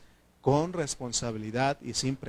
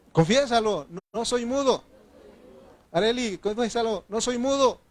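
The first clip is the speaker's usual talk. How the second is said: fervent urging; 9 semitones higher, 4 dB louder than usual. Real, not acted. Urging the church to confess.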